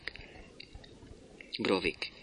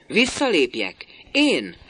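sharp, higher, louder outburst; jumping up; 7 semitones higher, 6 dB louder than usual. A narrator's reading. Master builder jumping up at Brovik, outraged disbelief. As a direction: leap up and fire the question back. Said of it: "Szólnesz fölpattan".

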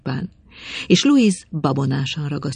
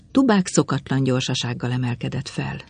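usual speaking voice

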